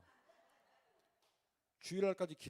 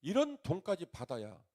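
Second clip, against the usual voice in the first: higher, louder indignant plea; a retold furious prayer, higher and louder; angry prayer; 5 semitones higher, 5 dB louder than usual. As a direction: cry out to God in protest. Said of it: "막 열받아가지고".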